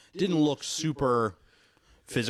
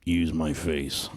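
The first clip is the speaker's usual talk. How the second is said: gruff Harrison Ford; 6 semitones lower, 4 dB louder, much slower than usual. Pretend. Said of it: "Unless he's like".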